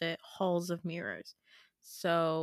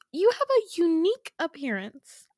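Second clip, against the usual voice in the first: prim polite remark; 9 semitones higher, 5 dB louder than usual. Retold or imitated.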